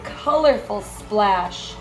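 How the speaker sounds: plain reading voice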